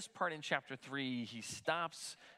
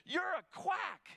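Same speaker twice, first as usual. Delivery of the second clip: much higher, slow mock yell at the doctor; retold yell; slower than usual. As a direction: snap it out as an angry patient. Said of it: "yelling and stuff".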